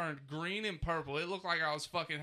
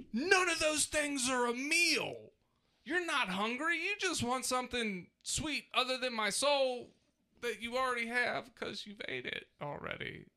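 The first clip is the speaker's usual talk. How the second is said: much higher, near-crying exasperation; exasperated outburst; 8 semitones higher than usual. Real, not acted. Exasperated complaint about his kids.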